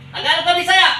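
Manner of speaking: high, loud demand; angry shout. Real, not acted. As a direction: shout the challenge at the spirit. Healer repeats confrontation. (galit na sigaw)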